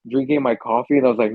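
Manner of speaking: normal talking voice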